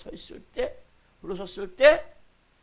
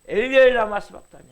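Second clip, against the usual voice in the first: higher and louder, crying out; 4 semitones higher, 12 dB louder than usual. Retold or imitated. Preacher reenacting Jesus' cry, loud and raised. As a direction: cry it out like a dying man. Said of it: "예수께서 그 소리를 내시되".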